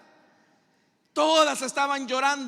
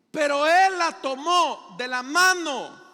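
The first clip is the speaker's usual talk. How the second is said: louder, slow fervent proclamation; preaching at full force; 4 semitones higher, 8 dB louder, slower than usual. Acted. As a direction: proclaim Jesus taking control. Preaching climax. (proclamación fuerte y lenta)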